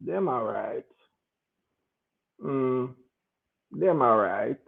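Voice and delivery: low, soft noncommittal mumble; grudging half answer. Retold or imitated. Imitating a Jamaican's grudging reply about the friends. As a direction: give a reluctant half answer. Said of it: "Hit a Jamaican".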